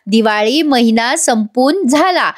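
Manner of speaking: slow, unsure word order; halting, jumbled recital. Retold or imitated. Madam mixing up the sentence, slow and unsure. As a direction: stumble slowly through the phrase. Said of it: "वाक्याची थोडी link तोडत म्हणतात".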